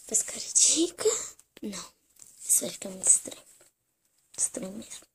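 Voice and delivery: whispered self-talk; whispered aside. Acted. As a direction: whisper it to yourself. Doll whispers to herself. (sussurrando pra si mesma)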